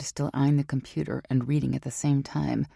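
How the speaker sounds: ordinary delivery, nothing marked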